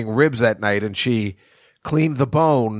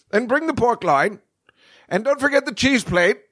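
high, chirpy voice calling for more food; higher-pitched mock customer calling out orders; mock diner ordering; 12 semitones higher than usual. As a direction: call the waiter like a greedy glutton. Imagined himself ordering more.

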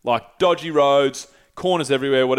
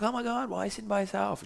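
put-on high, quick, soft gush as a tipsy girl; drunk girl; 8 semitones higher, 9 dB quieter, faster than usual. Drunk girl to lone traveler, mock high-pitched surprise. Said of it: "She was drunk".

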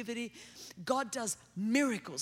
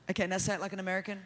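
normal talking voice

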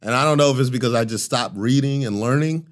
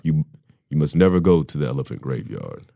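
Mufasa voice; 6 semitones lower than usual. Pretend. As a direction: show how Mufasa should sound, deep and commanding. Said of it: "you sound like Mufasa".

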